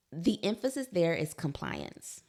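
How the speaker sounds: ordinary talk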